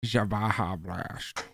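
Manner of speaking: "Ja Baja Blast" drawn out slowly, blurring into "blasht"; odd slurred pronunciation. Real, not acted. Friend naming a soda to his buddy, oddly slurred pronunciation. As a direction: stretch "Ja Baja Blast" slow and slushy. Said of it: "Just the way you said that".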